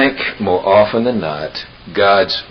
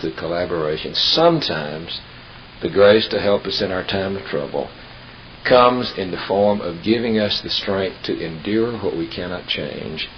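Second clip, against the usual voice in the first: ordinary talk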